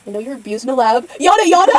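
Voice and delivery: loud brushing-off yada yada; dismissive. Acted. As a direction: wave it off loudly.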